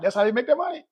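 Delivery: normal talking voice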